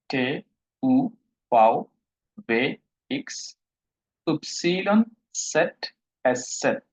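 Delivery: German letter names recited singly with short pauses; steady, spaced alphabet drill; model alphabet repeat. Shown as a demonstration. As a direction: read out each letter distinctly for the class. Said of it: "তাহলে আরেকবার বলি".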